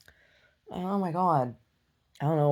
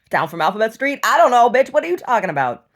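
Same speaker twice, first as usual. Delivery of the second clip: louder, higher mocking comeback; sassy retort; 5 semitones higher, 8 dB louder than usual. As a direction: snap back at her. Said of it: "I'm like".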